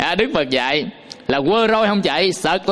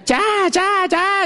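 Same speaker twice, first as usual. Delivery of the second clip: high-pitched mock riding cry; playful, high "chá" calls to a horse; imitating Tiểu Yến Tử; 12 semitones higher, much slower than usual. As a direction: urge the horse on in a much higher voice. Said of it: "Tiểu Yến Tử mà lúc mà lên con ngựa".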